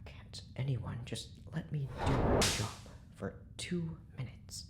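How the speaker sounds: whispered grumble under his breath; exasperated whisper. Acted. Doctor grumbling under his breath at the interruptions, whispered. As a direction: mutter it to yourself, fed up.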